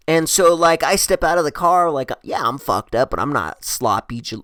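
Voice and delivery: ordinary talk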